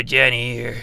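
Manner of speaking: usual speaking voice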